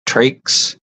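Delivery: trakes said TRAYKS, for tracks; dialect pronunciation. Shown as a demonstration. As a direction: say trakes with a long ay. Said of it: "for tracks".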